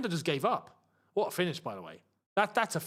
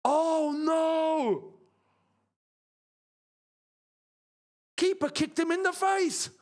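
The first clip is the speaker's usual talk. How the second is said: man down shout; 9 semitones higher, slower than usual. Real, not acted. A player injured on the pitch.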